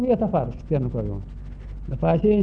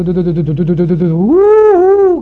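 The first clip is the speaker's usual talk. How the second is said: train imitation; 7 dB louder than usual. Wake game leader leading the line into the house, train noise. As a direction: play the train, then blow the whistle. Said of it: "ca trenul".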